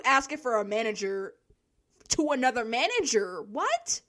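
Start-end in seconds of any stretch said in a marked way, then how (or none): none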